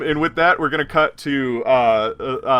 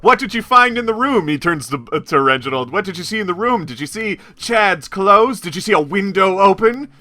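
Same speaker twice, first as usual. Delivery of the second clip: higher, louder, pressing questions; pushy reporter; 6 semitones higher, 4 dB louder than usual. Acted. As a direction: pepper them with questions.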